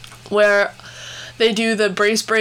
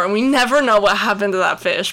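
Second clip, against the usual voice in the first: ordinary talk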